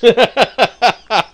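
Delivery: loud high laugh. Real, not acted. Laughing at his own joke.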